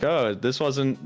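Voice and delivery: normal talking voice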